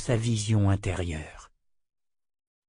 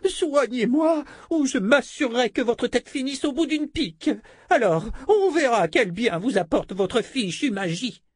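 whiny voice; 13 semitones higher than usual. Acted. Noblewoman threatens the wizard.

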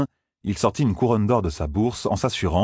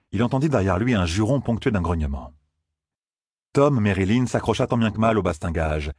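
ordinary delivery

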